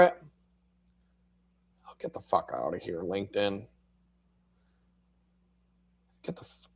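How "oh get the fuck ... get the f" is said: muttered irritation. Real, not acted. Annoyed at LinkedIn popup.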